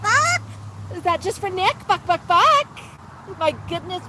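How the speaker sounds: high, sing-song coaxing voice for a parrot; pet talk. Acted. Host cooing at her parrot Max on livestream, high sing-song. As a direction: coo and coax the bird like a toddler.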